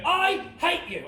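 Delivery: high, drawn-out mock yell; angry dad. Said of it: "we're just yelling in each other's faces".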